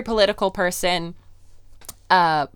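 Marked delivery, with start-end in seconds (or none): none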